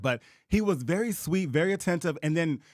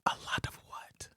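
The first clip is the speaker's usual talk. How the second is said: teasing whisper; ASMR whisper; 11 dB quieter than usual. Pretend. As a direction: whisper the question softly. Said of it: "Give us a little taste".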